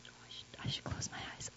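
hushed, unsure whisper to God; whispered prayer. Retold or imitated. Retelling how she began praying at the meeting, whispered. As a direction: breathe the words like a shy first prayer.

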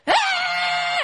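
put-on high shrieking kid's scream; mock kid scream. Imitating kids screaming wildly at Pororo Land. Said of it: "run around and scream on top of your lungs".

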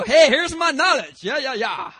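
high, loud, laughing show-off boast; show-off know-it-all. Retold or imitated. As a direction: brag like a smug know-it-all. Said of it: "they just want to let everybody know".

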